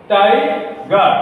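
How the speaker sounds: টাইগার slowly as টাই, pause, গার; two separate syllables. Shown as a demonstration. Teacher summing up two syllables, টাই and গার said apart. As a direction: split টাইগার after টাই. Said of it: "দুইটি শব্দাংশ একসঙ্গে জুড়ে".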